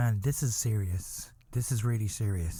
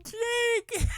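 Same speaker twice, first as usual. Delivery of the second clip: very high squealed wail; piercing fake-grief howl; mock crying. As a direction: cry the name out, absurdly overdone. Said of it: "I'm crying".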